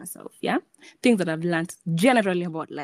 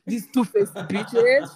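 laughing; 4 semitones higher, 7 dB louder, slower than usual. Real, not acted. Joking insult, laughing.

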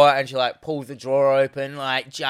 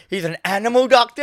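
incredulous; 9 semitones higher, 5 dB louder than usual. Retold or imitated. Mock incredulous quote of a misreading.

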